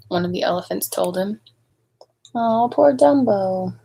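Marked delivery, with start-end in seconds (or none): 2.3-3.8 slow, pitying sigh of words; sympathetic aside. Real, not acted. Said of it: "reader's sympathetic aside".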